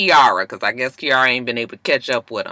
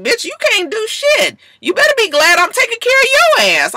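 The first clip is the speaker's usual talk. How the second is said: much higher, louder mocking put-down; mock boyfriend insult; 4 dB louder than usual. Mimicking [name] belittling [name], high and mocking. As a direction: sneer it in a squeaky mimic.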